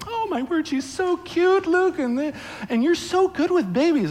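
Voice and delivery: very high gushing squeal; gushing girls. Retold or imitated. Girls at the track meet gushing over Luke with the baby, high swoon. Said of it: "And they're like".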